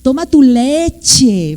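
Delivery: slow, drawn-out LECHE; over-pronounce "leche" as if teaching a toddler; exaggerated parental diction. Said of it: "hasta hacemos más dicción". (dicción exagerada de papá, "leche" alargado)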